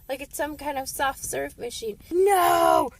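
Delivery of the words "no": loud, higher shout of disgust; shocked no. Real, not acted. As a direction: yell it out in horror. Disgust at poop-eating dogs.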